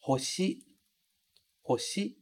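"hoshi" with an audible h at the start; clear h in hoshi. Shown as a demonstration. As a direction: say "Hoshi" breathing out the h. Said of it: "lembrando de pronunciar o H".

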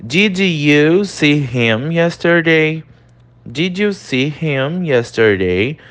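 teacher reading the example aloud; slow model sentence. Shown as a demonstration. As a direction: read the question slowly twice.

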